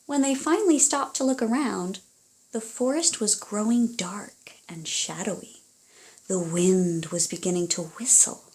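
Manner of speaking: lively storybook reading aloud; reading to children. Acted. Parent reading a picture book to a child, animated storytelling. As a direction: read it like a bedtime story to little ones.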